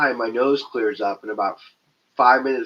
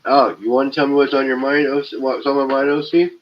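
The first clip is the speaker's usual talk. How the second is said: mock confrontation; 4 semitones higher, 6 dB louder, faster than usual. Mock challenging a chatter.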